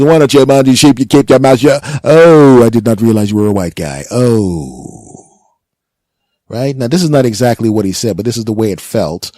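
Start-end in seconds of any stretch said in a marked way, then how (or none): 0.0-1.8 loud, harsh bark at a suspect; angry cop yelling. Retold or imitated. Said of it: "And he goes". 2.0-5.1 loud, exaggerated "oh" of sudden realisation; mock surprise. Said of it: "And he goes".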